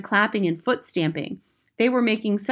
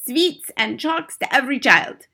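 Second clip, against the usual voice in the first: put-on accented, high witch proclamation; Grand High Witch; 6 semitones higher than usual. A narrator's reading. Witch addressing her audience.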